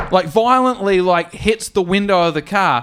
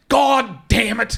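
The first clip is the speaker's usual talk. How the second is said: weary grumble; 7 semitones higher, slower than usual. Retold or imitated.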